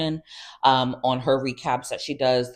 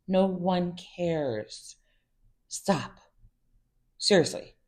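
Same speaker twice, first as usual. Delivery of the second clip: slow emphatic dismissal; 3 semitones higher, 5 dB quieter, slower than usual. Real, not acted. Dismissing Bravo storyline.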